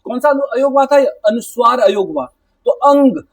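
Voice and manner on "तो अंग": अंग said louder and higher, nasal ं leaned on; अंग with heavy emphasis on the ं; stress on अंग. Shown as a demonstration. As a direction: press hard on the nasal in अंग. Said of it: "यहाँ पे आपका जोर पड़ रहा है".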